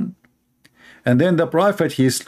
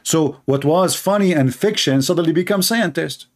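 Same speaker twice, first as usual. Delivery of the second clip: usual speaking voice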